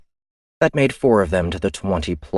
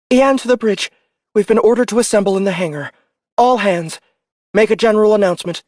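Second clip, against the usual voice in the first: higher, louder, fast orders into communicator; brisk orders over the comm; officer on comms; 6 dB louder, faster than usual. Acted.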